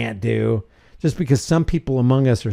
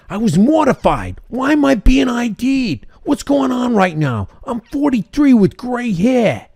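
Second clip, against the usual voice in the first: high, exaggerated New York accent; 9 semitones higher than usual. A shocked shopper quoted in a hammed-up New York accent. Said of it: "You gotta see it in a New York accent".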